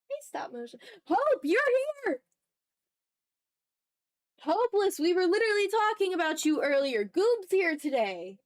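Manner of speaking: high, loud, calling out happily to arrivals; excited greeting. Real, not acted. Streamer greeting regular viewers joining chat, loud excited welcome. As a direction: welcome them in with big cheer.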